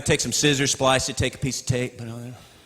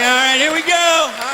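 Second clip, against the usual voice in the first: loud, higher psyching-up before a run; pumped-up jogger; 10 semitones higher, 6 dB louder than usual. Retold or imitated. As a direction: hype yourself up to start jogging. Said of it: "You're like".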